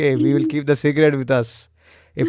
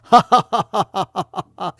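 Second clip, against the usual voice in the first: demo laugh; 3 semitones higher than usual. Shown as a demonstration. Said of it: "you're just gonna make the sound".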